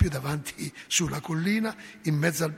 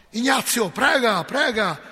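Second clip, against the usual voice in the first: urgent command; 6 semitones higher, 8 dB louder, slower than usual. Retold or imitated. Padre urging him to pray.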